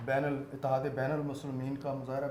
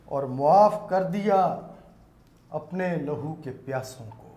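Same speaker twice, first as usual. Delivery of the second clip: naat recitation; 6 dB louder than usual. Acted. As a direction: declaim it with reverence. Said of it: "نعت کے چند شعر پیش کرنا چاہوں گا".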